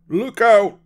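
very high, loud warning yell; shouted warning. A narrator's reading. Driver warning passengers of stampeding buffalo, shouted warning. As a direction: yell it to warn the whole coach. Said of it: "shouted the driver".

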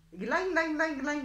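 high, loud run of glans; bouncy storybook sound effect; clanging drop noises. A narrator's reading. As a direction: ring out each glan like falling pellets. Narrator imitates goat droppings falling.

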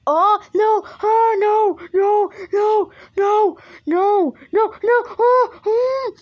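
high, loud, terrified cries; panicked wailing. Real, not acted. Player hit by a jump scare, panicked wailing. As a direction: wail in panic, ending in a whimper.

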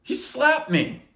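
high, loud, slow outrage; indignant complaint, voice up high; just got slapped. Acted. Reporting the slap, outraged.

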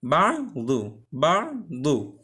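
বাদু drawn out very slowly, daad held; slow Arabic demo. Shown as a demonstration. Teacher models final-position বাদু slowly. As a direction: stretch বাদু, holding the heavy daad. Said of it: "এবং শেষে বাদু".

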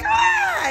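high blissful ah; blissful sigh. Retold or imitated. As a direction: let out a high happy ah. Voicing the body's bliss.

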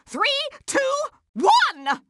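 shouted count. Acted. Counting down to fairy world, shouting the final number. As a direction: shout the last number like a launch.

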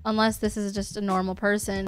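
ordinary talk, nothing marked

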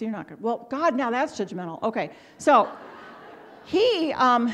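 ordinary talk, nothing marked

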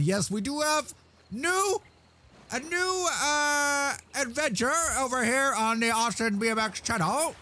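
high hype voice for the new channel; high announcer. Acted. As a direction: announce it like a hyped presenter. Announcing new TikTok adventure.

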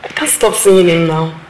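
loud desperate defiance; loud defiant confession to aunt; defiant outburst. Acted. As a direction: shout back your love.

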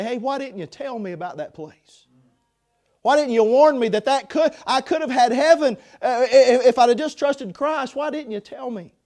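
a lost soul crying out why no one warned them; pleading reproach. Pretend. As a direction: accuse a friend who never warned you.

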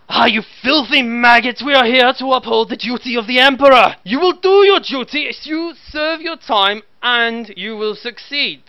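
loud, high barking drill officer; furious commissar yelling orders; shouting commissar. Acted.

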